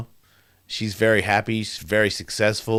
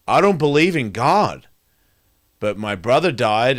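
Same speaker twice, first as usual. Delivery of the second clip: higher, louder, pleading cry; a raised, shaken prayer; desperate plea; 4 semitones higher, 5 dB louder than usual. Retold or imitated. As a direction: beg the ceiling for your life. Praying on the hospital table.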